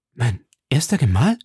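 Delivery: puzzled echo, sharply rising at the end; bewildered. A narrator's reading. Milady questions Lord Winter's mention of a husband, bewildered. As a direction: repeat it as a baffled question. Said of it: "mit verwirrten Mienen".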